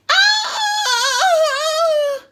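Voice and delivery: high, loud, sustained howl; long wailing scream. A narrator's reading. Perfect Peter sent to the head, wailing scream. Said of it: "screamed Perfect Peter".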